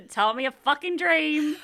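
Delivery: loud, slow mock-bossy demand. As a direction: bark it out as a playful order. Mock demand to tell dream.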